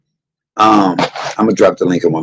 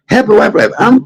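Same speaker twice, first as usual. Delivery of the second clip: excited squeal. Real, not acted. Excited greeting viewer name.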